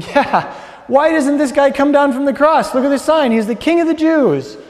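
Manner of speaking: high, loud jeering taunt; soldiers mocking. Roman soldiers taunt the man on the cross, loud high jeer. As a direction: sneer it like a rowdy soldier. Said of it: "Roman soldiers picked up on that theme and began mimicking".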